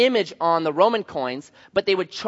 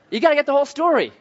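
fast, high and louder; emphatic; 9 semitones higher, 4 dB louder, much faster than usual. Shown as a demonstration. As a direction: drive the point home.

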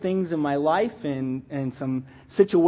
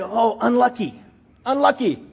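lightly attempted European soccer coach accent, higher; a hint of European accent, pitched up; European coach's accent; 4 semitones higher than usual. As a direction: imitate a European coach, accent half-done. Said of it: "I can't deal with that accent".

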